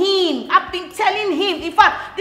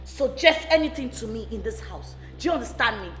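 ordinary delivery

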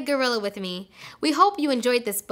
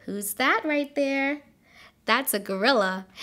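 ordinary delivery

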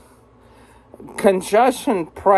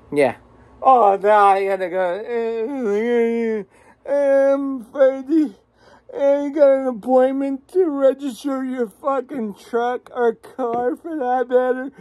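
ordinary delivery

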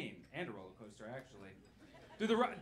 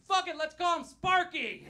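high, loud careless yell; rowdy drunken bellow; drunk dad shout; 12 semitones higher, 12 dB louder than usual. Retold or imitated. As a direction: shout it like a tipsy dad naming a pet. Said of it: "my dad was ... away from being like".

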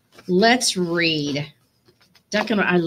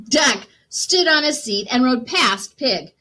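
ordinary delivery, nothing marked

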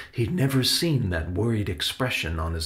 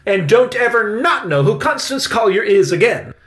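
very high, loud indignant warning; ordering; 13 semitones higher, 10 dB louder than usual. A narrator's reading. Victoria warns Benny never to forget Collier, indignant order. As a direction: warn the child off, outraged and emphatic. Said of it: "Victoria ordered".

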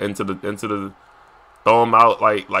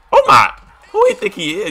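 incredulous squeak; 4 dB louder than usual. Real, not acted.

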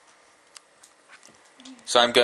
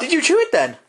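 much higher incredulous question; surprised; 12 semitones higher than usual. Real, not acted. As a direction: ask in disbelief.

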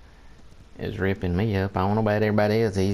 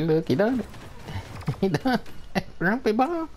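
incredulous squeal; 11 semitones higher than usual. Real, not acted. Gamer squeals at the enemy's play.

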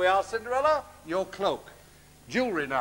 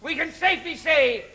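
much higher, screeching panto dame voice; shrill panto ugly sister; 7 semitones higher than usual. Acted. Ugly sister orders Cinderella to tear the ticket, in a high screeching falsetto. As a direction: lord it over Cinderella in a piercing falsetto.

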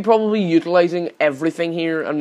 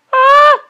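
high-pitched scream; 9 dB louder than usual. Real, not acted. Sheep about to fail.

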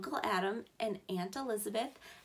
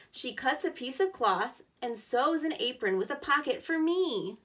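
louder, lively storybook reading; reading to children; 5 dB louder than usual. Acted. Teacher reading the aunt's sewing page to kids, louder storybook voice. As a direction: read each line clearly for young listeners. Reads picture book aloud.

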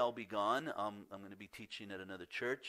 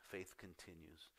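Faith continues repeated low and hushed; soft echo of the point; 4 semitones lower, 12 dB quieter than usual. Shown as a demonstration. Pastor repeating his second point softly to sink in. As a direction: let "Faith continues" fall away quietly. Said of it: "my second point".

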